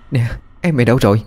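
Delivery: mildly alarmed, hurried demand; anxious questioning. A narrator's reading. Mother asks daughter about missing brother, anxious questioning.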